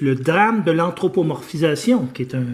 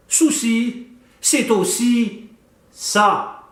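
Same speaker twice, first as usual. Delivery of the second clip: higher, deliberate theatrical recital; poetic declamation; 6 semitones higher, 5 dB louder, slower than usual. Acted. Host reciting a text on the Dundas Street painting, poetic declamation. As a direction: declaim each phrase with measured pauses.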